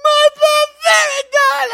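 very high, loud and slow sobbing wail; hammed-up sobbing shout. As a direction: blubber it out like a heartbroken girl. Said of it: "she's like".

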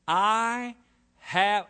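"I" drawn out and loud; stress on I. Shown as a demonstration. Preacher showing the attitude of anger, heavy stress on "I". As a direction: lean long and hard on "I". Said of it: "emphasis on the word I".

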